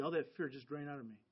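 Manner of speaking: normal talking voice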